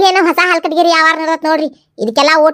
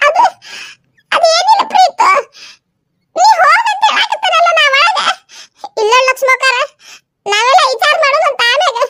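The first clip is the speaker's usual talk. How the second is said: higher, nervous laughing woman; higher voice, flustered and laughing; 10 semitones higher than usual. Acted. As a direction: caught off guard, stammering in a high voice. High character covering up.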